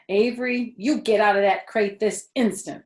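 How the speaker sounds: loud stern mother, name hissed; stern command. A narrator's reading. Mother ordering Avery out of the crate. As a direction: order him out now. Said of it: "commanded his mother".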